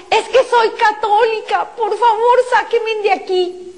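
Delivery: high, pleading cry, slowing on the last words; desperate cry. Retold or imitated. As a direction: cry out in despair, pitched high. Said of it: "y en ese desespero grito".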